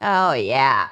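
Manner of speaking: hammed-up Minnesotan accent. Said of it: "unleash their worst Minnesotan accents".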